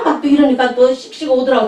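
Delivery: usual speaking voice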